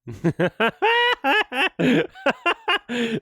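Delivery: high, real burst of laughter; loud cackle. Real, not acted. Reacting to a funny line delivery, high hearty laughter. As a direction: crack up in a long, helpless laugh.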